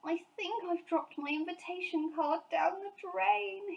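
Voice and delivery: bitterly disappointed bear. A narrator's reading. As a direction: lament the lost card. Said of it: "he exclaimed bitterly".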